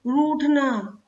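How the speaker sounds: रूठना said slowly with a long ऊ; slow model word. Shown as a demonstration. Teacher showing long ऊ, says रूठना slowly. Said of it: "ये होगी बड़े ऊ की मात्रा".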